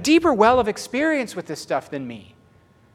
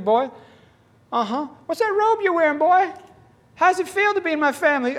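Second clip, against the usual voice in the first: higher, pleading voice of the Lord calling his son; 9 semitones higher, 3 dB louder than usual. Retold or imitated. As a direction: gently press him like a loving father. Voicing the Lord's tender plea.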